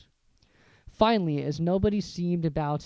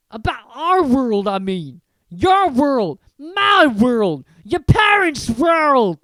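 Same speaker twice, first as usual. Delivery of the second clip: slow, loud, higher insistence; emphatic Hagrid; 9 semitones higher, 12 dB louder, slower than usual. Acted. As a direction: hammer home each world. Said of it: "Hagrid simply waved his hand and said".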